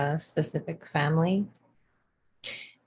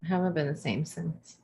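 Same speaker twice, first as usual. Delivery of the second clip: softer, muttered afterthought; quiet repeat; 8 dB quieter than usual. Pretend. Repeating her joke lament quietly to her cohost. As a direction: let it drop to a rueful murmur.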